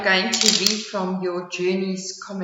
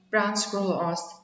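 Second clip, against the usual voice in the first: ordinary delivery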